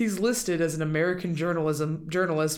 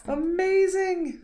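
high drawn-out; 13 semitones higher, slower than usual. Real, not acted. Savoring the funny name.

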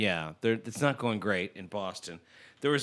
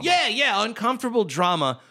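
excited agreement; 10 semitones higher, 4 dB louder than usual. Real, not acted. Eager agreement.

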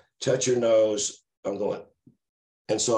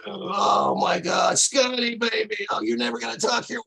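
much higher, loud cackling voice of a wild friend; Ray's crazy laugh; 4 dB louder than usual. Retold or imitated. Drunk friend mocks driver being pulled over, high cackling. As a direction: crow it out, half laughing at his buddy's trouble. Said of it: "started laughing that crazy laugh of his".

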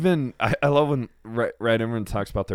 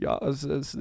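higher mock German accent; bad mock German accent; 7 semitones higher than usual. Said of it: "if you wanna go German accent".

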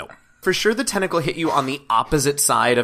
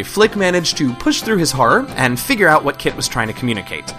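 narration; 2 semitones higher, 5 dB louder than usual. A narrator's reading. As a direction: lively recap narration. Game master narration recap.

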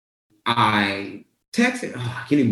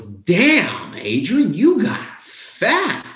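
high, drawn-out mock blurt from the friend; retold loud blurt; 8 semitones higher, much slower than usual. Best friend at the door, blurting it out, high and slow. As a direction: replay the friend's blunt jab, higher and slow. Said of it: "The words I heard just loud was".

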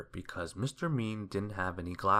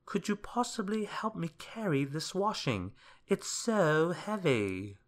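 put-on high-pitched old woman pleading; old washerwoman; 9 semitones higher than usual. A narrator's reading. Washerwoman asking Mister Mean for help, high and pleading. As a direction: plead high, dragging out the ending. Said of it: "an old washerwoman".

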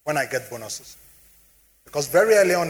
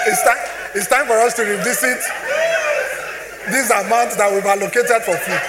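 louder and higher, laughing through it; laughing shout; 7 semitones higher, 5 dB louder than usual. Real, not acted. Telling the audience it's time to revisit the food budget, laughing shout. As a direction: shout it out while still laughing.

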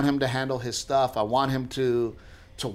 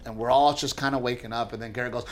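ordinary delivery

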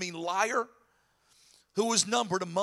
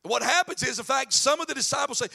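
ordinary delivery

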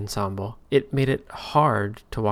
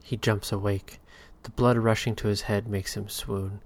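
ordinary delivery, nothing marked

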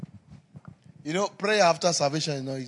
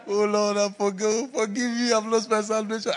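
pitched-up, half-laughing mock wail; mock crying prayer; 6 semitones higher than usual. A mock tearful wail, retelling his prayer after the fight. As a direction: ham up a tearful plea for forgiveness. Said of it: "I cried".

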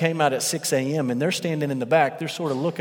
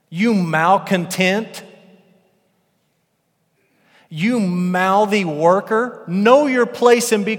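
loud, high, slow berating; hammed-up tirade at the workers; mock scolding master; 5 semitones higher, 7 dB louder, much slower than usual. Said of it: "he doesn't say any of that".

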